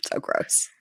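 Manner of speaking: breathy whisper of disgust. Real, not acted. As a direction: mutter it under your breath. Disgusted aside.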